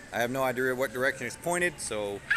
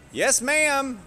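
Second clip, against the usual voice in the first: shouted reply; 12 dB louder than usual. Real, not acted. Answering wife shouting across store.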